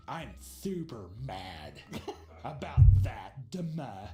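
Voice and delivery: slow, low, sulking mock boyfriend; mock sulky boyfriend. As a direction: drag it out like a pouting guy. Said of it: "he's just like".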